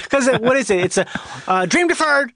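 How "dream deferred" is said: blurted out high; shouted line. Retold or imitated. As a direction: call it out, much higher. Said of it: "shouting them out in a weird way".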